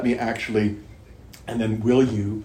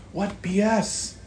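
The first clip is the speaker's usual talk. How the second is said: incredulous scoff; 9 semitones higher, much slower than usual. Real, not acted. Outburst at own past guilt.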